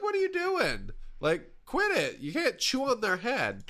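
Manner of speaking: high-pitched exasperated scolding; scolding the dog. Retold or imitated. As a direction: scold a rowdy puppy, up high. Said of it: "I'm like".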